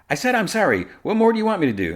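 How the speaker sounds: high-pitched mock exasperation; exasperated apology. Lois to an angry mother, exasperated. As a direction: protest high, imitating a fed-up woman.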